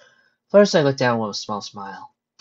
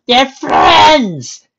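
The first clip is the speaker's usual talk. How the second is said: high, full-volume comic yell; author shouting; 14 dB louder than usual. Acted. Author shouting somewhere out there, high loud yell. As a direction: shout it from far away, exasperated. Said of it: "you hear the author shouting".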